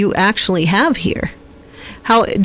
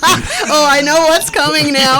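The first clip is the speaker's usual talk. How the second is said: higher voice breaking into real laughter; laughing; 7 semitones higher than usual. Real, not acted. Co-host hears the blooper story coming, laughing. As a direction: laugh through the words, pitched up.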